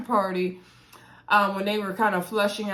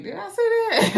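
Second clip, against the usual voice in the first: high, laughing mock-shocked squeal; 12 semitones higher, 4 dB louder than usual. Host imitating Cameron caught out at the wedding-night story, high laughing shock. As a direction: play Cameron caught off guard, half laughing. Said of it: "Cameron's looking shocked".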